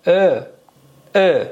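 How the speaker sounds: "ا" between e and o, lips drawn wide; stretched vowel. Shown as a demonstration. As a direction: say an isolated "ا" with jaw forward and lips stretched. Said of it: "اما کشیده تر". («ا» کشیده، بین e و o)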